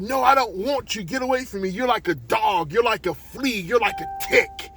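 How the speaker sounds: high, heated insults; angry, scornful rejection. Acted. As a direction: drive them away with mounting contempt. Owner scornfully rejects the mother.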